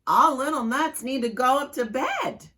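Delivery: mama calling the nuts to bed loudly; big mama voice. Acted. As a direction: call the kids up to bed firmly. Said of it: "in her big voice".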